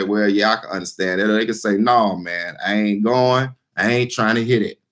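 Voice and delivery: a low, lazy voice for the folks who won't budge; low voiced bystanders. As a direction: drop the pitch well down and shrug off the idea. Voicing people who refuse to agree.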